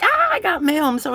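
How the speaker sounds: put-on high, loud childlike squeal over mail; a sudden high burst of mock delight; excited squeal. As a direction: squeal like a kid getting mail. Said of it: "And I'm like".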